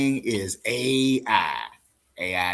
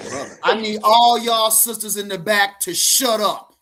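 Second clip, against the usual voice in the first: a louder hammed-up pastor telling the back row to hush; mock preacher scolding; 6 semitones higher, 7 dB louder, slower than usual. Mock pastor hushing the chattering sisters in the back, loud. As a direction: playfully bark at the sisters in the back.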